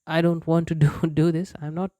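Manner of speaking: normal talking voice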